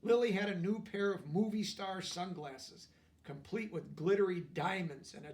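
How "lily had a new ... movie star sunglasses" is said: ordinary delivery, nothing marked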